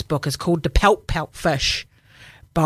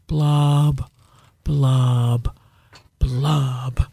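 slow sad fish bubbles; underwater blub blub; 6 semitones lower, 4 dB louder, much slower than usual. Acted. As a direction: drop each blub lower and glummer. Fish ends its refrain.

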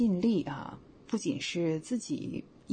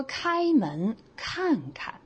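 slow demo read; 7 semitones higher, much slower than usual. Shown as a demonstration. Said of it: "你再比如说".

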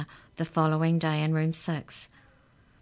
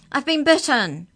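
much higher, louder frantic yell; 9 semitones higher, 10 dB louder than usual. Acted. Amy yells down the phone to Jake, much higher and louder. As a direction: shout it down the phone in a panic. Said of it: "Amy was yelling".

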